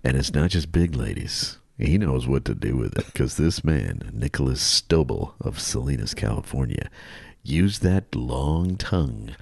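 low mock-sultry storytelling; slow seduction for the ladies. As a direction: linger suggestively, in the manner of a soul crooner. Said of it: "read this a little slower for the ladies".

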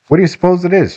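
put-on high-pitched, curious boy's question; lightly voiced boy, asking eagerly. A narrator's reading. Boy asks about mystery package.

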